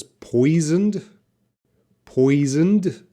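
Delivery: "Poisoned" ending on a clear d sound, not -ed. Shown as a demonstration. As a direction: make the final d in "Poisoned" heard. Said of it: "we'll have a D sound".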